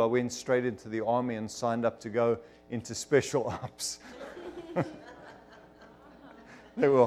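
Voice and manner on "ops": normal talking voice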